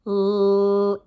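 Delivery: "l" held long on a steady note, "llll"; one continuous "l" sound; stretched-out l. Shown as a demonstration. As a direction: sustain "l" with no vowel. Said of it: "this sound says l".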